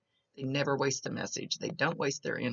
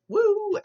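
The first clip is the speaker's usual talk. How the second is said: loud, very high woo; imitated whoop of excitement; party whoop, high and held; excited whoop; 10 dB louder than usual. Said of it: "but he's excited".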